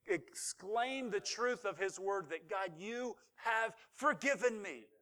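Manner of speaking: drawn-out, weighted words to God; slow emphatic declaration. Acted. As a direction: let each word land slowly. Climax of the claimed forgiveness.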